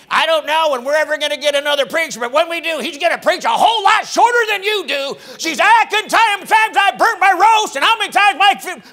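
put-on high, loud complaining old lady; sarcastic old lady. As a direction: nag the preacher like a feisty old lady. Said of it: "she was so sarcastic".

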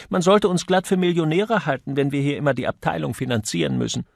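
high-pitched indignant complaint. A narrator's reading. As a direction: protest in outrage, speeding up towards the end. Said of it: "rief Miss Parton entrüstet". (entrüstet)

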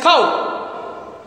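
"cow" with a closed, rounded ow; wrong vowel demo. Shown as a demonstration. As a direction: show "cow" the mistaken way, without the open ah. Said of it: "pronounce like this".

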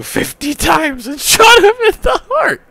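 sudden leap to a very high, loud voice; incredulous shriek. Real, not acted. Reader stunned by fifty stabs and a shot, shrieking. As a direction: break into a shriek mid-sentence. Reader's outburst at absurd violence.

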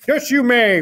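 high, loud put-on farmer voice; reply heartily as a storybook farmer; Farmer Brown. A narrator's reading. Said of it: "replied Farmer".

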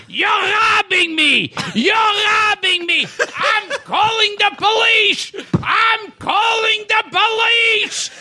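high, hammed-up accusing yell; outraged shouting. Old boss catching a model at his safe, shouting in outrage.

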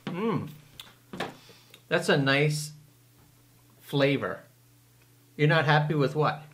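talking while chewing; eating a cookie; mouth full. Real, not acted. Seen 1: Man in cap holds cookie, bites it at 6.6 and 7.7.

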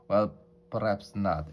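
usual speaking voice

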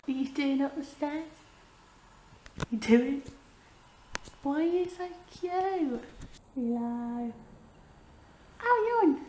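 high cooing pet voice; sing-song talk to a cute pet. Real, not acted. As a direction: coo at the cat on the stairs. Talking to her pet.

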